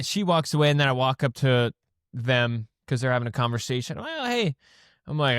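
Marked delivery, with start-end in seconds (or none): 4.0-4.5 mock high greeting. Mimicking a greeting in the story.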